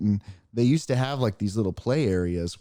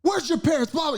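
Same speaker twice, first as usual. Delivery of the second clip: much higher, put-on angry rant; angry man screaming. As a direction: imitate the furious dad ranting. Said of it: "He's, like, screaming at me".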